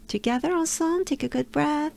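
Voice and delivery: usual speaking voice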